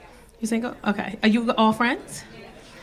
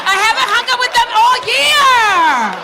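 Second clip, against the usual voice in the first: emphatic shout; 9 semitones higher, 5 dB louder, slower than usual. Real, not acted. Friend shouts defending herself.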